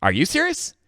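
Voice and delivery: giddy excited echo. Retold or imitated. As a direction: fire it back in delight. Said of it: "I was like".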